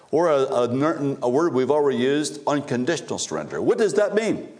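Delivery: fiery preaching. Acted. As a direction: build it up like a preacher in full force. Preaching climax.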